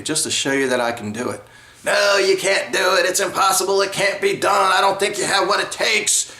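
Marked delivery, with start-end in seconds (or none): 1.8-6.3 higher, scoffing imitation of a job interviewer; mock dismissive interviewer. Job interviewer turning down a free trial, higher mocking dismissive voice. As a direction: act out the boss who flatly won't give him a chance.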